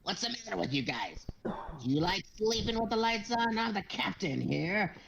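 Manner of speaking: high, pushy sailor voice; bossy Flint. Acted. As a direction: pitch it up and boss the crew around. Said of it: "and he goes".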